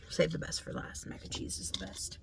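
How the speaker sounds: unvoiced aside to herself; whispering to self. Real, not acted. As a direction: whisper it under your breath. Choosing food, talks to herself.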